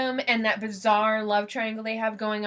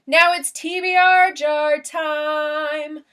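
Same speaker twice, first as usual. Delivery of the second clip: loud, higher, drawn-out segment call; playful announcement; 4 semitones higher, 6 dB louder, much slower than usual. Real, not acted. Vlogger calls out the jar draw to viewers, playful announcement. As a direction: announce it big, stretching the words out.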